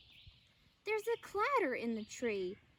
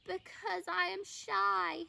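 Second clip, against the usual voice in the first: trembling voice; 5 semitones higher than usual. A narrator's reading. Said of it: "a trembling voice says".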